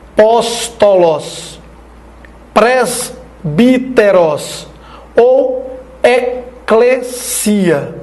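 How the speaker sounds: very slow, each syllable set apart. Shown as a demonstration. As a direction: break "apóstolos", "presbíteros" and "eclesia" into syllables for learners. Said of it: "quando tem quatro sílabas". (bem devagar, separando sílaba por sílaba)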